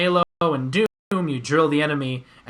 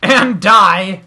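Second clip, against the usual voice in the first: shouting; 5 semitones higher, 11 dB louder than usual. Real, not acted. Dying in game, shout.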